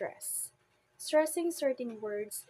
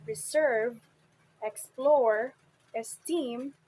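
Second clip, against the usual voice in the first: reSERVE, exPLORE, esTEEM, second syllable louder; stress on second syllable; 7 dB louder, slower than usual. Shown as a demonstration. Teacher lists example words, stressing second syllables of reserve, explore, esteem. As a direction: lean hard on the end of reserve, explore, esteem. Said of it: "on the second syllable".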